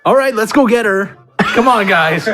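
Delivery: ordinary talk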